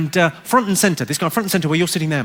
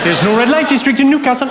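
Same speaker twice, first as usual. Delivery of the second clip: high, sing-song Geordie; Geordie accent; 6 semitones higher, 3 dB louder than usual. Retold or imitated. Cab driver volunteering information to passenger, lilting Geordie. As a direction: lilt it like a Newcastle cabbie. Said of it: "trying to start a sing-song".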